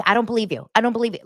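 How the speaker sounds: mildly heated impersonation; imitated mad disbelief. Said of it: "he's so mad about her".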